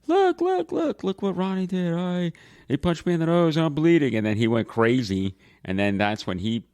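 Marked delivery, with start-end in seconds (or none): 0.1-4.1 high-pitched imitation of a tattletale; mock tattling child. Brother imitates sister tattling to Dad, high and urgent. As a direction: mimic a kid running to tell Dad.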